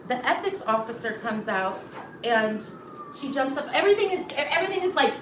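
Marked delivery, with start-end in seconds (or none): none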